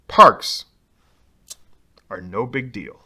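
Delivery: ordinary delivery, nothing marked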